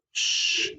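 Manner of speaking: "sh" as one sustained voiceless hiss; isolated sh sound. Shown as a demonstration. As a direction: hold "sh" alone, with no vowel after. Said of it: "our first sound".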